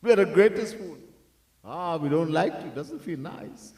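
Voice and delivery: ordinary delivery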